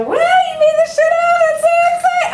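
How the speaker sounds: very high, loud, gushing mock cheer; mock gushing praise. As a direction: ham up the gushing congratulations. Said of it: "the glory and the wow".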